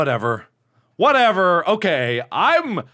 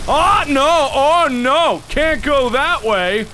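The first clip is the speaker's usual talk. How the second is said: raised, drawn-out cries of dismay; alarmed exclaiming; 5 semitones higher, slower than usual. Real, not acted. Player finding the way blocked, alarmed exclaiming. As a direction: call it out in rising panic.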